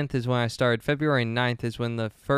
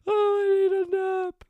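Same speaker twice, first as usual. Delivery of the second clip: very high, slow squeaky wail; mock whine; slower than usual. Real, not acted. Host worn out by their own confusion, high mock whine. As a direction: squeal it out, drawn out and helpless.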